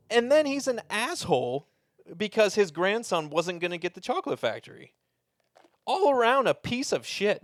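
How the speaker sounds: high, worked-up rant about a villain; rising rant. Real, not acted. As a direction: build into an indignant pitch-high tirade.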